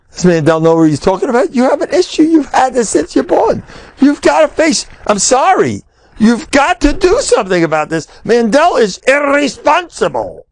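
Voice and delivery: high-pitched, outraged rant, hammed up; mock indignant tirade. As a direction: imitate an indignant busybody laying down the law. Retold askan's indignant tirade.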